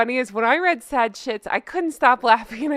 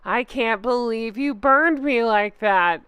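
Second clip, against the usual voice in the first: ordinary talk, nothing marked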